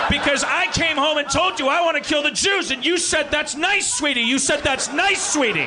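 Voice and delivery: angry shout. Acted. Hitler screams at his wife in the bunker, furious. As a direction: yell at her, pitch way up.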